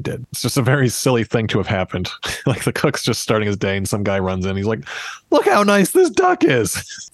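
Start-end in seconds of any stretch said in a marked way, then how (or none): 5.3-7.1 high, drawn-out mock enthusiasm; mock gushing. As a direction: gush slowly and high, playing an overeager servant. Retold servant gushing to cook.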